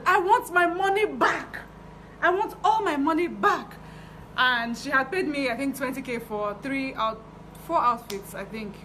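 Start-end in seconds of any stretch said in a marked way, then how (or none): 0.0-3.8 high, loud, slow mock demand; retold shouting. Client demanding a refund from the tailor, slow mock yell. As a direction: drag out each word like an angry customer, pitched up. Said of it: "She shouted and shouted".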